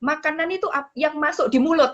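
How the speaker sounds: hammed-up indignant officer, high pitched; imitating angry officer. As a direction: lecture the traveller like the cross customs lady, high. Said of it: "Dia marah".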